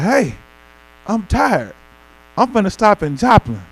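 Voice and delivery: lightly put-on St Louis accent. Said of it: "I got my little Saint Louis accent now".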